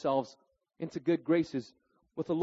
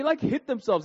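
usual speaking voice

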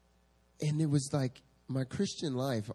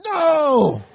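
much higher, loud crying no; inner scream; 15 dB louder than usual. Retold or imitated. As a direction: scream it in panic. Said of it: "Inside, I'm screaming".

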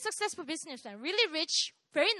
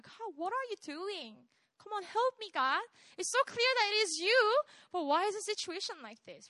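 retold plea to God, pitch swooping higher; imitated pleading; 6 semitones higher than usual. Alone in her room questioning God, retold pleading.

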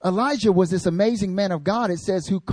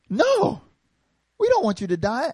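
shocked protest; 8 semitones higher than usual. Retold or imitated. Disciples protest Jesus' death.

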